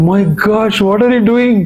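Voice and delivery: high, astonished exclamation; amazed cry. Acted. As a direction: gasp it out in disbelief. Villagers amazed at the lifted hill.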